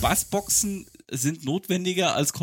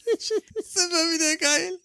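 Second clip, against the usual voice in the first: laughing through the words; giggling while talking; slower than usual. Real, not acted. Laughing while watching clip.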